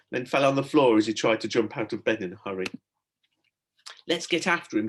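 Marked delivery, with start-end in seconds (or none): 4.1-4.9 squeal. A narrator's reading. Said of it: "he squealed".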